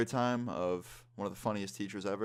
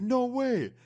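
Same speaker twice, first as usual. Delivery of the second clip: very high-pitched mock astonishment; amazed disbelief; 4 dB louder than usual. Friend learning it really is the teacher, high-pitched disbelief. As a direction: imitate a friend's incredulous squeal.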